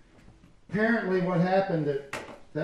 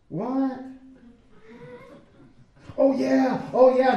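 mock agreement; 6 semitones higher, 6 dB louder, slower than usual. Mock agreeing, growing louder.

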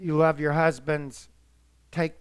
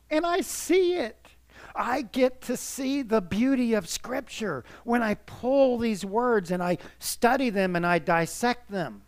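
rising emotion; 6 semitones higher than usual. Real, not acted. Preacher moved, voice lifting high.

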